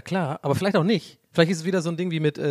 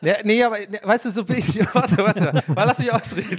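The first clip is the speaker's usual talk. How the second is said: loud, laughing, higher pitch; laughing protest; 8 semitones higher, 5 dB louder than usual. Real, not acted. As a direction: laugh while fending off teasing. Laughing protest, being teased.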